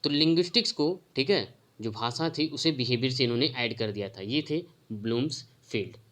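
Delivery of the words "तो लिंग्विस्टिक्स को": ordinary talk, nothing marked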